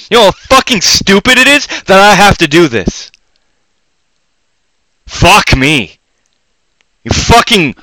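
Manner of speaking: loud, high furious rant; frustrated ranting. Real, not acted. Ranting at viewers who won't fund him, loud and furious. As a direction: yell it out in real exasperation. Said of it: "I am fucking frustrated".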